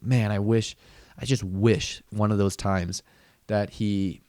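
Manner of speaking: ordinary talk, nothing marked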